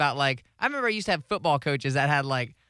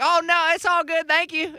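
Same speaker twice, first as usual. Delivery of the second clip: high, put-on Southern accent; mimicked Southern accent; 11 semitones higher, 4 dB louder than usual. Man at drive-thru mimicking accents, high Southern accent. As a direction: slip into a chirpy Southern accent. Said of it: "Mainly with Southern".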